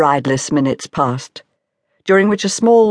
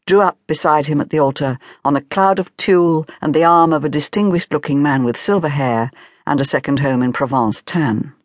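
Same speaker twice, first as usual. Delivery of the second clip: usual speaking voice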